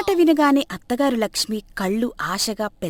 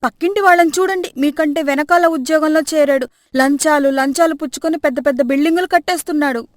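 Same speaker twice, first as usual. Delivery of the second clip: higher, louder furious rant; angry scolding; 5 semitones higher, 6 dB louder than usual. Acted. Wife rants at husband about his job, loud and angry. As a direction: tear into the husband. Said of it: "కోపంతో సురేష్పై విరుచుకుపడింది".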